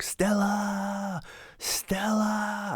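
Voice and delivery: high, drawn-out cry of Stella across the stage; Brando Stella cry. Pretend. Stanley calling up the stairs to Stella, a long anguished wail.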